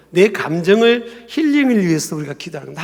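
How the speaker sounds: ordinary delivery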